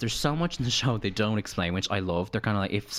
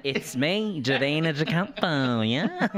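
raised, drawn-out bad impersonation; badly imitated drag queen, higher pitched; Davina De Campo; 4 semitones higher, much slower than usual. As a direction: stretch the name like a mock diva. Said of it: "My terrible impression".

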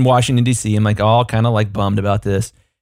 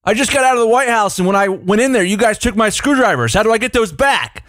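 mock yell through the fence, higher pitched; calling through fence; 10 semitones higher than usual. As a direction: call across the lawn to the guard, pitched up. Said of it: "So I yell through the fence".

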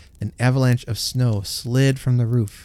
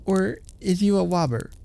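calling out; 11 semitones higher, 4 dB quieter than usual. A narrator's reading. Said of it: "Then he cried".

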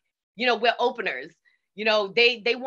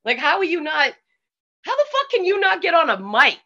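imitating friends, higher and baffled; mock disbelief, voice pitched up; incredulous friends; 8 semitones higher, 5 dB louder than usual. Friends scoffing at her stage fright, imitated incredulous. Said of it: "even then, they were like".

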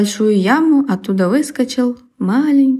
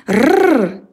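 little rolled rrr growl; growling dog voice; 5 semitones higher than usual. Acted. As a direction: growl like a puppy. Puppy imitates growl mid-sentence.

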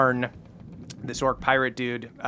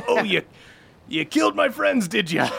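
orc pirate voice; 7 semitones higher than usual. Acted. GM voices Yarn, hurt surprise.

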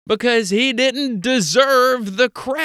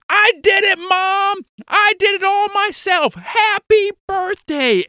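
put-on very high, boastful little-kid voice; little brother; 13 semitones higher, 4 dB louder than usual. Little brother taking credit to mom for breakfast, high kid voice. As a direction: pipe up proudly like a small boy claiming credit. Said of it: "your little brother who's in the kitchen".

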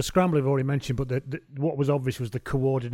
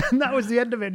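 ordinary talk, nothing marked

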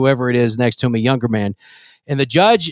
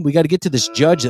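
ordinary talk, nothing marked

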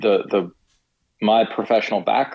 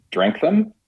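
eager joke; 11 semitones higher than usual. Retold or imitated. Joking first reaction to bourbon.

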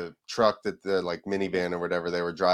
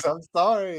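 laughing apology; 9 semitones higher, 3 dB louder than usual. Retold or imitated.

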